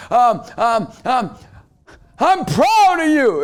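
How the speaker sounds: loud, high, drawn-out event-speaker boom; preacher mode. Retold or imitated. New dad addressing his baby, in loud preacher mode. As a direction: proclaim it as if to a packed event. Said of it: "so I went into preacher mode".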